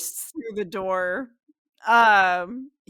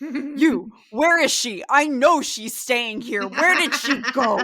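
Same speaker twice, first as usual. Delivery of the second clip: loud, booming demand in a raised pitch; booming angry voice; 4 semitones higher, 8 dB louder than usual. Acted. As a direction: burst in shouting, furious and demanding. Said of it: "a booming, angry voice".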